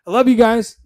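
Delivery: a bright, loud burst of I love you guys; loving sign-off. Real, not acted. Host signing off to his viewers, loud and bright. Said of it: "Look at that. I just said the love word".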